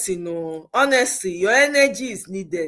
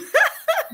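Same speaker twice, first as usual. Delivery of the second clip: high laugh. Real, not acted. Joke about TikTok meeting.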